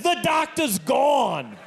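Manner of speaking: exasperated shout. Retold or imitated. Batman cutting off the patient's request, exasperated shout. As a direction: shout it, fed up and final.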